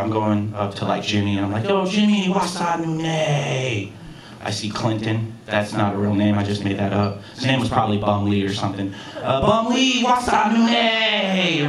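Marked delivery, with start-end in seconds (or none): 1.7-3.9 drawn-out call to a coworker; hearty greeting. Retold or imitated. Acting out cheerful greetings to coworkers. 9.4-11.7 high, long-held hearty call; calling out greeting. Retold or imitated. Acting out calling to a coworker.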